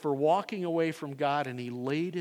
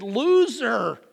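loud, higher scornful "Loser!"; a jeering insult hurled loud; mocking jeer; 8 semitones higher, 13 dB louder than usual. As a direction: spit it out like a crowd sneering. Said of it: "they looked and said".

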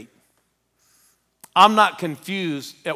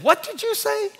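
much higher, squeaky disbelief; astonished squeak. Retold or imitated. Pastor asks the agent to repeat himself, squeaky astonishment.

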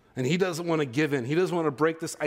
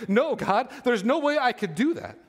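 mild mock protest; 6 semitones higher than usual. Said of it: "He goes".

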